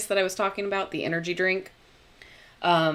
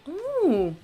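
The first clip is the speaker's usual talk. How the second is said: usual speaking voice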